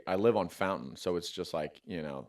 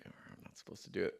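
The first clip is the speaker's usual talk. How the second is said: whispered fast, caught out; hushed, quick, caught in the act; guilty whisper; 4 semitones lower, 13 dB quieter, faster than usual. Retold or imitated. Dad whispering to his kid, caught swearing. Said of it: "he kinda looked at me like".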